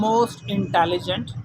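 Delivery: most stretched out slowly and stressed; careful teacher emphasis. Shown as a demonstration. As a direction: drag out most intelligent. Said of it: "teaching superlative form".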